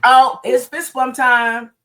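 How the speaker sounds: drawn-out hype announcement, slow and loud; hyped up. Real, not acted. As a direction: pump up the crowd, stretch every word. Hosts greeting stream with hype.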